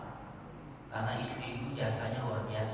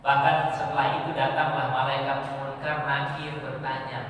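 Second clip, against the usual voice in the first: usual speaking voice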